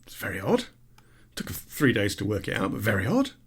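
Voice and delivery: low, quiet puzzled musing; puzzled librarian. Acted. Librarian puzzling over the chicken, low and quiet. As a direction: muse it low and softly to yourself. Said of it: "Librarian thinks".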